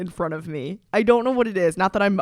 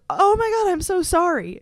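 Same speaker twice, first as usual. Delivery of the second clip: high, louder gasp of sympathy; shocked sympathy; 11 semitones higher, 4 dB louder than usual. Retold or imitated. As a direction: gush apology in shock.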